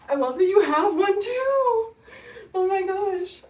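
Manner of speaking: high, loud, laughing through happy tears; real overjoyed laugh-cry; teary delight. Real, not acted. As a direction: gush with real joy, almost crying. Viewer has the same hoodie.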